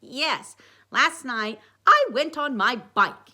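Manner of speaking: slow, loud mock-British Sherlock accent; Sherlock Holmes accent. A narrator's reading. Dwight reporting his investigation, in slow Sherlock accent. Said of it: "using his Sherlock Holmes accent again".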